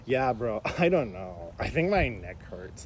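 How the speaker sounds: low, slow slacker bro voice; mock-pained drawl; imitating whining passenger. Imitates Ricky's repeated neck complaint.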